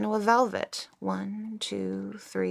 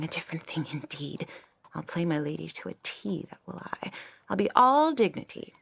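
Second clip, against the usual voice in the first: usual speaking voice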